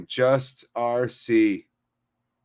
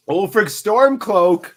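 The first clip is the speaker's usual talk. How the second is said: higher, louder, slowly stretched name call; grand announcement; 8 semitones higher, 6 dB louder, slower than usual. Acted. Streamer hailing a chatter's name, big and slow. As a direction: boom the name out like a herald.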